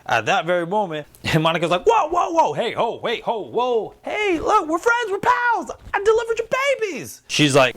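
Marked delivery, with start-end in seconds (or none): 1.9-7.2 very high, hammed-up frantic squeal; panicked pleading. Pleading with an attacker that they are friends, comic high-pitched panic. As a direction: beg for mercy in a mock falsetto panic. Imitates Monica begging not to be attacked.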